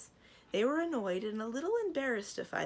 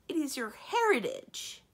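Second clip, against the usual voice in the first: high, loud, shocked insistence; a shocked fairy insisting; 8 semitones higher, 8 dB louder than usual. Acted.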